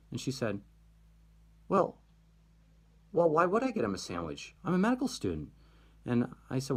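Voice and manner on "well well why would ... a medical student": high-pitched mock indignation; retold indignation. Medical student objecting to fetching a sandwich, retold indignation. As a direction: protest as the offended student. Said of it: "she was mad about this".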